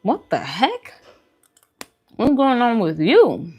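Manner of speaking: usual speaking voice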